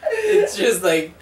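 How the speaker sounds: high, slow, giggling words; apologising through laughter. Real, not acted. Host apologising to listeners for losing it, laughing. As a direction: fight real laughter to get it out.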